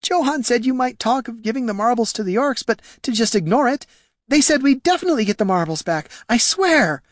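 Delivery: high, tearful excuse-making; tearful pleading. Acted. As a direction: plead your case close to tears. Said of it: "Tears welled in Jelana's eyes".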